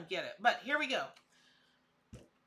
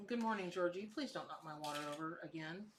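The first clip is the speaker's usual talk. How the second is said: quiet aside; 5 semitones lower, 8 dB quieter than usual. Real, not acted. Aside to someone in the room.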